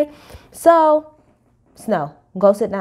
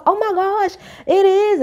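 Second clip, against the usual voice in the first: mock surprise; 11 semitones higher than usual. Mimicking Lisa's surprise.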